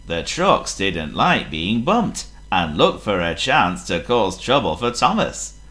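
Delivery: usual speaking voice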